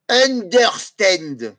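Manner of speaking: Understand drawn out very slowly, each syllable held; slow English demo. Shown as a demonstration. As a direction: spell out Understand slowly for the class. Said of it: "Alors, je vais vous le dire".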